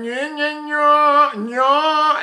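mock forward placement. Teacher mocks everybody singing forward, high pushed nya whine. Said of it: "everybody's going".

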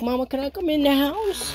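high, put-on little cat voice; playful voice for the cat asking to come inside; voicing the cat. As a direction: speak for the kitty as a pleading child. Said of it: "Kitty was like".

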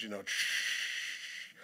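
unvoiced drawn-out shh of change; pouring coins. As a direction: make the slow rush of coins.

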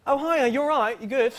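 high, chirpy English greeting; British accent. Retold or imitated. Caught fibber greets the girl he fooled in a high British accent. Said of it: "so that was my British accent".

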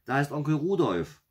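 high, loud alarmed call; crying out. A narrator's reading. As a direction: call it out like a startled girl. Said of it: "rief sie".

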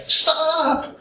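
high, drawn-out mock yell; high-pitched "stop" call, stretched out; demonstrated yell. Shown as a demonstration. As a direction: yell it out long and high, without full volume. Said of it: "please yell".

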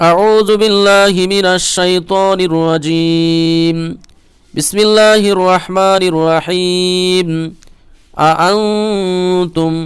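Quran recitation. Acted. Teacher begins the lesson reciting in tilawat style.

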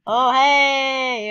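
loud surprised yelp; startled shout. Real, not acted. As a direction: jump at the sudden scare. Startled by game character.

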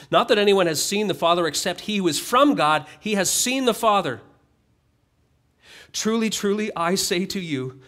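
preacher reading Jesus's words aloud; scripture reading. Acted. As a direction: proclaim the verses to the congregation.

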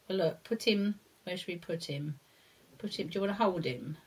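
ordinary talk

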